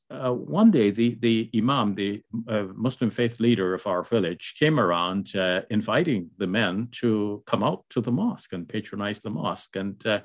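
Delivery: ordinary delivery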